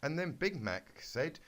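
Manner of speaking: ordinary delivery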